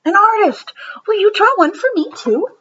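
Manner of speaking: high, bright-eyed surprise; delighted stranger. Acted. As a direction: delight at meeting a little artist. Delighted woman in line.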